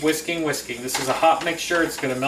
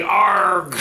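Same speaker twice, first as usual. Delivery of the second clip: pirate growl; 4 semitones higher, 7 dB louder than usual. Pretend. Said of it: "Talk Like a Pirate Day".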